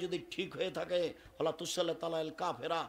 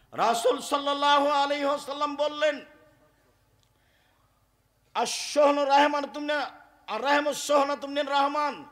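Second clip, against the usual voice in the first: loud, high chanted hadith recitation; preaching at full force; 7 semitones higher, 9 dB louder than usual. Acted. Preacher proclaiming the Prophet's hadith loudly. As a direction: proclaim it to the whole hall.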